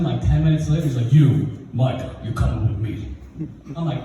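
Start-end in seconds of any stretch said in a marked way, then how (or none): 1.1-3.1 a hint of authority, ordering a pupil along; stern principal. Principal summoning a boy from class, sternly. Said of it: "Principal comes in".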